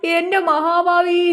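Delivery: slow, high, drawn-out wail; wailing reproach. Acted. Mother learning the bride is Tamil, wailing. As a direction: stretch the cry out in dismay.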